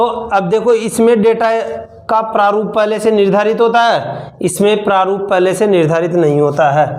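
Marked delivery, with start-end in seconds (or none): none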